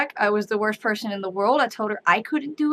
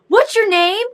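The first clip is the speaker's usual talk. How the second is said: put-on high, loud toddler question; little girl voice; 10 semitones higher, 6 dB louder than usual. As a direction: ask it like an eager small child. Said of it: "she goes".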